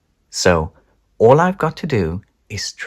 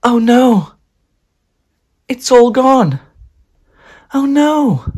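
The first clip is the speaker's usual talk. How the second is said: much higher, louder, mock panic; 7 dB louder, slower than usual. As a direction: cry out as it dawns. Panic, cake gone.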